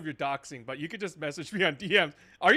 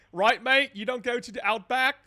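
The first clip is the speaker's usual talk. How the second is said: a lightly put-on Australian accent, higher and louder, half laughing; mock Australian accent; 6 semitones higher, 6 dB louder than usual. Streamer joking with an Australian viewer in a hammed-up Australian accent. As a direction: tease the chat with a jokey Aussie "mate". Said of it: "I don't know how to do a British accent... I mean, Australian accent".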